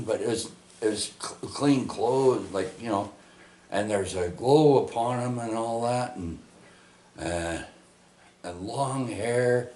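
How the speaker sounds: ordinary talk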